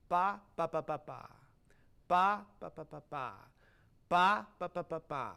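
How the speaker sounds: "Ba" accented and detached, last "ba" falling away; displaced downbeat. Shown as a demonstration. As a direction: punch each opening "Ba", drop the rest. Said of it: "we're displacing the downbeat".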